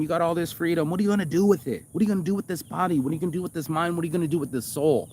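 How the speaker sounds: rally yourself like a coach; pumped-up pep talk. Retold or imitated.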